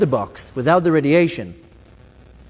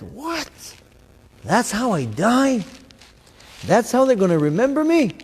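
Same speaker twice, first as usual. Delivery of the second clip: stunned disbelief; 7 semitones higher than usual. Retold or imitated. Retold shock at own death.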